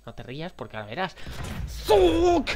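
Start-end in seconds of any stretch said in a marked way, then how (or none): 1.7-2.5 yelped sound effect; high shouted nonsense sound. Real, not acted. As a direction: squeal it out.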